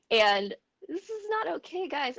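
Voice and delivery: high, tearful plea; real tearful emotion. Real, not acted. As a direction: push the words out through tears. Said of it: "I'm so emotional right now".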